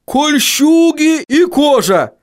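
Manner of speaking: high, drawn-out dwarf outburst; high-pitched indignant dwarf cry; stunned bark. A narrator's reading. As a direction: bark it high and slow, utterly dumbfounded. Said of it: "рявкнул совершенно ошеломлённый Хороша гора".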